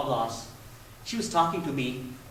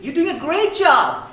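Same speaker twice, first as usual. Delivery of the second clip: put-on high, louder praise for a child; enthusiastic praise; 12 semitones higher, 8 dB louder than usual. Father praising his daughter's learning, enthusiastic. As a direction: cheer her on.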